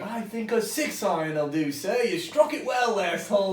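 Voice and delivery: a proper British caddy advising a club. Retold or imitated. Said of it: "Why is it a robot British?".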